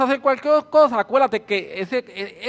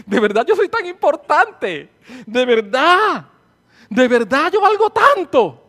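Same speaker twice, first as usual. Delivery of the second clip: laughing through the question, amazed; giddy disbelief with a laugh; laughing wonder; 5 semitones higher, 6 dB louder than usual. Real, not acted. Preacher marvelling at her worth, laughing. Joyful wonder at being valued.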